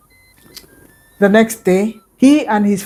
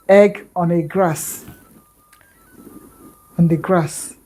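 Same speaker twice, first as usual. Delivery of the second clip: ordinary delivery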